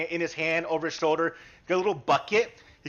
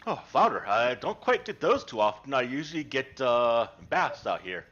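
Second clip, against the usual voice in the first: lower, unhurried humble fisherman; gruff-free friendly old fisherman, lower voice; fisherman Dale; 8 semitones lower than usual. Acted. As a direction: speak as a polite, simple fisherman.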